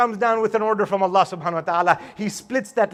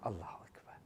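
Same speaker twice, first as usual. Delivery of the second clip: whispered awe; 24 dB quieter, faster than usual. Real, not acted. Moved aside while narrating.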